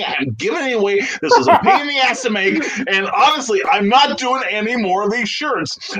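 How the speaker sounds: half-laughing, fed-up insistence; laughing exasperation. Retold or imitated. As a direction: laugh while protesting it's too much work. Frustrated rant to Stan about shirt.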